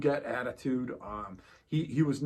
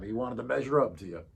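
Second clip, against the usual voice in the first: usual speaking voice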